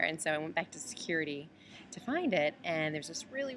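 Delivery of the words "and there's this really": normal talking voice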